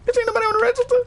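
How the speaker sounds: very high, squeaked mock protest; indignant retort. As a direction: shoot back in a high put-on voice. Retold retort to fussing coworker.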